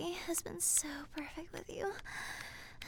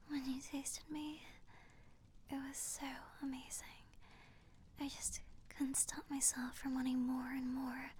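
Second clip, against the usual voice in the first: tender whisper; 3 semitones lower, 6 dB quieter than usual. Pretend. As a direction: murmur it tenderly.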